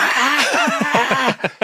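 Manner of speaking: high piercing scream of alarm; panicked scream. Retold or imitated. Actor recreating his on-set scream for the director, high shriek. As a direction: shriek as if someone stole the kid. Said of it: "So I went".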